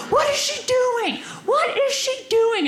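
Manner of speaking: high-pitched exasperated yell, imitated; mock shouting. Truck driver questions the crowd about her, mock shouting. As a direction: demand it like a baffled, irate driver. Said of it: "he's just shouting at me".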